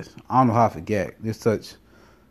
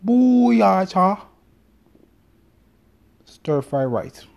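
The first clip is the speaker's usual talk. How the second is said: badly faked Chinese accent; mock Chinese accent; 8 semitones higher, much slower than usual. Showing off accents to his wife, fake Chinese accent. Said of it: "I can speak Chinese".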